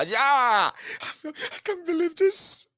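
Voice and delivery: high, slow, laughing disbelief; mock giddy disbelief. A voter mocking joy at the concession news, high and laughing. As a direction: squeal it out as if stunned.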